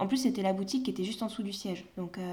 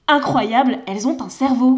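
much louder, higher mock wonder; sarcastic amazement; 4 semitones higher, 13 dB louder than usual. Mocking people surprised that saleswomen think, sarcastic. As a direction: feign astonishment sarcastically.

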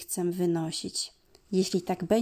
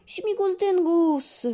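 loud, high, slow festive shout; dad calling out while splashing; 10 semitones higher, 6 dB louder, slower than usual. Acted. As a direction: call it out like a playful dad. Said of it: "woła tata".

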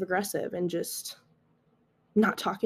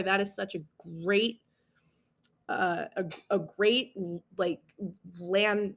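usual speaking voice

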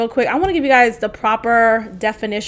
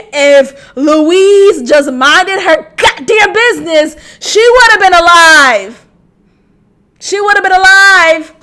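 raised voice, hammering each word; exasperated outburst; 5 semitones higher, 7 dB louder than usual. Real, not acted. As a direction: cry it out in exasperation, stretching the words. Exasperated outburst over character's fate.

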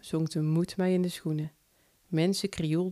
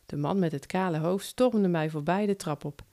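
ordinary delivery, nothing marked

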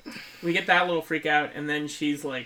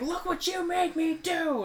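mock Annie. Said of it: "she's like".

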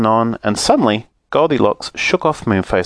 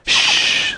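sharp unvoiced "shh"; cross hushing. A narrator's reading. Moonface silences father bear, a cross shush. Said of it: "said Moonface crossly".

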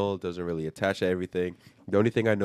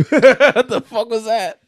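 high, loud startled laugh-out; real surprised laughter; laughing in surprise; 11 dB louder, slower than usual. Real, not acted. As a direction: burst out laughing at a strange noise. Startled by a toilet noise.

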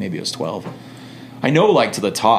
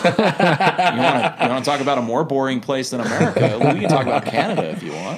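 laughing, high and quick; 3 semitones higher than usual. Real, not acted. Missionary laughing as he retells the Canada joke. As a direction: giggle through the punchline.

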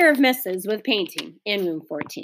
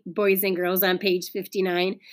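usual speaking voice

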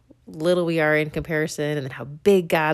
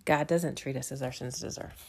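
softer, lower, to herself; quiet inner thought; 3 semitones lower, 8 dB quieter than usual. Real, not acted. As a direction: think it quietly in awe. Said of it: "I thought".

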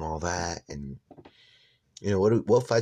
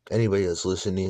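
usual speaking voice

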